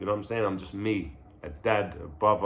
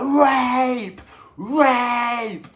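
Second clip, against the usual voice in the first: high, loud mock scream; imitated piercing scream; 12 semitones higher, 4 dB louder than usual. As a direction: shriek it like a frightened auntie.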